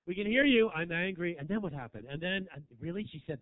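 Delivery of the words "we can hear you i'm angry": louder, stressing angry; loud complaint. Retold or imitated. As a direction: shout it at the front row. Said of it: "I was".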